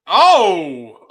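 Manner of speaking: loud excited gasp; excited shout. Real, not acted. Reacting to big move.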